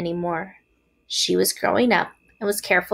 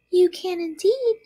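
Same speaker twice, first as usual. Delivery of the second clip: high little spider voice; 11 semitones higher than usual. A narrator's reading. Spiders reassure Wilbur, high small voice. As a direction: answer brightly in a tiny high voice.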